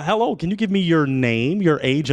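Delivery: usual speaking voice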